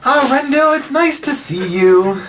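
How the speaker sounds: high-pitched, delighted greeting; welcoming aunt. Acted. Aunt behind the counter greeting her nephew, high welcoming voice. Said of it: "she gives him a warm smile".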